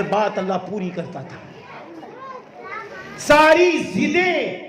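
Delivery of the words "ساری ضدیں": drawn-out emphasis. Acted. Slowed emphasis.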